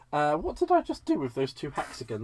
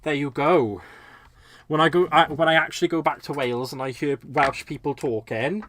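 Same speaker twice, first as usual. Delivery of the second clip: strong, louder Welsh accent; lilting, emphatic Welsh accent; 5 dB louder than usual. Shown as a demonstration. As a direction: let the Welsh half come out. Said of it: "about my Welsh half".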